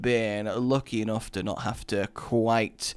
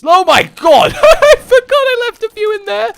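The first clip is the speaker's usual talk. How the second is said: high, loud laughing shriek; laughing surprise; 15 dB louder than usual. Real, not acted. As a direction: burst out laughing in shock. Surprised by leftover bombs.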